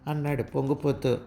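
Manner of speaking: plain reading voice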